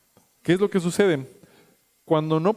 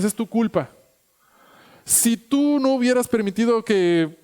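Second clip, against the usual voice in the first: reproaching God; 5 semitones higher than usual. Retold or imitated. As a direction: accuse the Lord, it's your fault. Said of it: "¿Será que nosotros le reprochamos a Dios".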